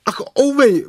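high, loud yelp of pain; cry of pain. A narrator's reading. Pooh yanked by the rabbit, yelping loud and high in pain. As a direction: yelp as if it hurts. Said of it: "vykřikl Pů".

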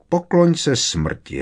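high, drawn-out villain's taunt; taunting invitation. A narrator's reading. Voldemort telling Harry to bow to death, drawn-out taunt. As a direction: stretch it out high and slow, falling at the end.

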